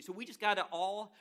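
ordinary talk, nothing marked

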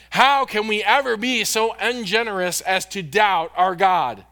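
emphatic pulpit reading that swells, then drops; impassioned quote reading. Acted. Preacher reads Spurgeon urging us not to doubt God, fervently. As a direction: build to a loud plea, then let it fall.

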